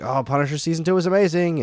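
mock-excited rave; imitated enthusiasm. Voicing enthusiastic friends' praise.

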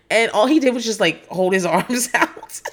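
usual speaking voice

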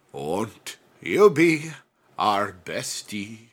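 drawn out, very slow sign-off. Pretend. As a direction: stretch each word like a corny farewell. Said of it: "What was that?".

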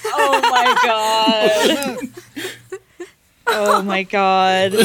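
slow laughing disbelief. Real, not acted. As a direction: drag it out through laughter. Reacts to silly voice.

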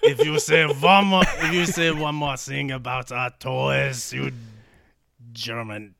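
put-on slow, threatening French accent; hammed-up French accent, drawn out. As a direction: warn them off as an annoyed French sailor. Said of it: "the French guy's like".

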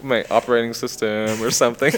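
chuckling through the words; laughing. Real, not acted. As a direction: say it while laughing at yourself. Joking about cyborg self.

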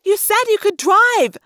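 high, loud accusation; shrieked, drawn-out reproach; accusing. A narrator's reading. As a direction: fling the accusation out, drawing out the words. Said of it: "Cassie accused".

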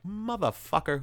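acted-out irritated swearing; mock exasperation. Voicing annoyed woman at phone ring.

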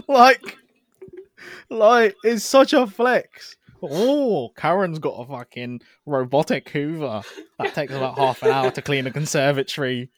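high, loud, laughing rant; mock it through laughter. Real, not acted. Laughing at imagined scene.

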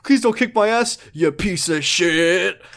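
high, loud, blustering punk voice, slowing on the insults; a cartoon tough guy barking then backpedalling; tough greaser bluster. Greaser bumps into Kiryu, high blustering tough-guy voice. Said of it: "and he's like".

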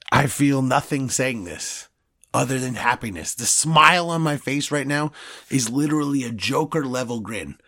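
usual speaking voice

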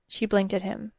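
ordinary delivery

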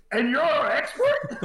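high, laughing imitation of a doubtful cop; laughing mock disbelief. Retelling the deputy's doubt, laughing high. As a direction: squeak it out through a laugh. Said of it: "he's probably thinking".

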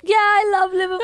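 high, insistent put-on Scouse; fake Liverpudlian. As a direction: protest in a hammed-up Liverpool accent. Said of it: "like a fake Liverpudlian".

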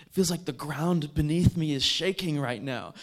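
loud, high, astonished outburst; retold astonishment at full volume; amazed exclamation.

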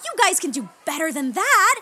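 put-on high, loud kids' party host; singsong puppet show host. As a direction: pump up the kids like a children's entertainer. Clown hosting kids' party.

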